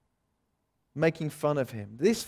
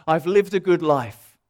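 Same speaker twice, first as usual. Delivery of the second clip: proud boast; 3 semitones higher, 8 dB louder than usual. Retold or imitated. Pride speaking to the congregation, loud and smug. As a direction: puff yourself up as proud. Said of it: "It tells us".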